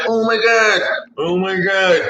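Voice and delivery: a hint of Kevin Hart gushing; Kevin Hart impression. Newbie fans gushing, a light Kevin Hart take. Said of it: "I did my little Kevin Hart impersonation".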